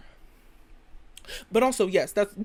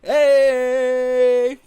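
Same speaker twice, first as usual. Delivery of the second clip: drawn-out shout; 5 semitones higher, 13 dB louder than usual. Acted. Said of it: "then it would be like this".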